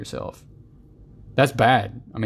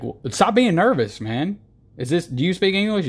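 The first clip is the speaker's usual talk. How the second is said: high, impatient scold; exasperated mock scolding; 8 semitones higher than usual. Scolding a nervous answerer, impatient. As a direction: scold the nervous talker, fed up. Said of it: "It's like".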